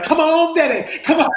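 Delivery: high, loud mock cheer; excited cheering. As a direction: egg him on in an excited high yell. Acting out Dusty cheering him on.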